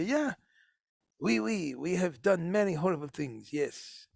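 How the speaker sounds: hammed-up French accent, half-hearted; bad French accent. Mocking French museum curators owning up, in a terrible French accent. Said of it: "that's a terrible French accent".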